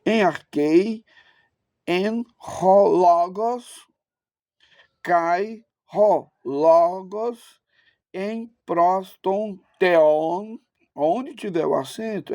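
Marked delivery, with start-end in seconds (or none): 0.1-1.0 "Em arquei" with weight on "quei"; "Em arquei", teacherly, accent on the end; stress on final syllable. Shown as a demonstration. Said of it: "Aqui é a sílaba tônica". 1.9-10.6 very slow, word-by-word didactic Greek; deliberate classroom reading of ancient Greek; Erasmian Greek pronunciation. Shown as a demonstration. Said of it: "Na pronúncia erasmiana".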